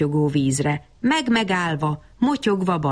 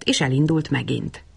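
usual speaking voice

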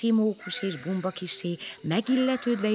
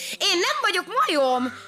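high, louder offended snap; indignant snap; 11 semitones higher, 4 dB louder than usual. Acted. Misi indignant at being called a monkey. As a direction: snap back proudly. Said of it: "csattant föl önérzetesen".

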